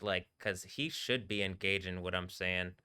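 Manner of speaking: ordinary delivery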